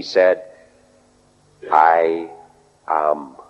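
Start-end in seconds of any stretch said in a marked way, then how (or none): 1.7-3.4 two low, weighty words split by a pause; solemn declaration. Retold or imitated. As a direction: declare it slowly, far deeper in pitch. Corrected reading spoken as solemn declaration.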